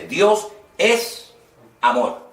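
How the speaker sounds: "Dios" hit high and hard, then "es amor" firm; emphatic. Shown as a demonstration. Teacher showing the class how an emphatic verse sounds, with a big lift on "Dios". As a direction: declare "Dios es amor" with no room for doubt. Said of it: "lo hacía de una forma enfática".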